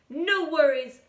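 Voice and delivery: hearty woof. Acted. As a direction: bark it out cheerfully. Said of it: "woofed Don Diego".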